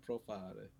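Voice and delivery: a soft, trailing Pro-FAR-eh; Italian-style Profar. Shown as a demonstration. Host repeats his pet version of the name, Profare, quietly trailing off. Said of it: "that's how I always say his name".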